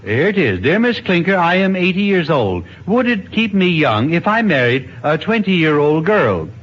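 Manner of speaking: ordinary talk, nothing marked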